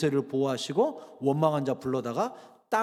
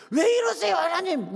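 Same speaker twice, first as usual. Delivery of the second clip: high, loud protesting cry to God; whiny complaint; 12 semitones higher, 5 dB louder than usual. Pretend. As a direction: complain to God, pitched up and indignant. (하나님께 따지듯 높고 크게 항의)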